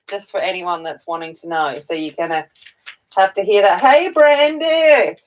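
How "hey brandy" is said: drawn-out greeting. Real, not acted. Greeting chat viewer.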